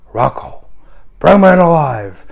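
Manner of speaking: sudden loud gruff Pacino bark; late Pacino voice. Retold or imitated. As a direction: bark it, Michael giving orders. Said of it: "he talks like this".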